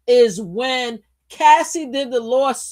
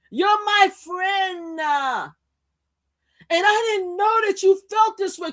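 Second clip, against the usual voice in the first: mock pushy [name]; 8 semitones higher than usual. Acting [name]'s loud reply.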